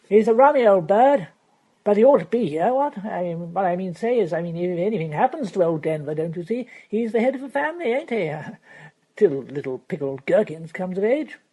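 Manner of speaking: put-on loud, rattling young toff; Freddy voiced. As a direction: babble on carelessly, with a laugh at the end.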